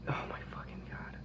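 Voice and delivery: unvoiced disbelieving whisper. Real, not acted. Vlogger whispers his dismay to the camera, a stunned whisper. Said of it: "whispered exclamation".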